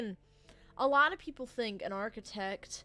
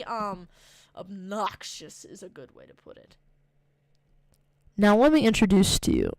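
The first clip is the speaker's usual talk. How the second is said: ordinary talk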